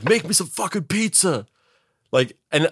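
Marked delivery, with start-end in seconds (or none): 0.0-1.5 much higher, bossy pretend order; mock demanding gunman. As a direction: bark the order like the hungry gunman. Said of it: "then he's like".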